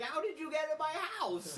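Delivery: imitating Willie. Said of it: "In his typical Willie fashion".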